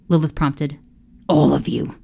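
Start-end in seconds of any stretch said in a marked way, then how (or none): 1.3-1.9 low whispered menace; low growl. Acted. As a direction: growl it under the breath. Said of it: "the Emperor growled".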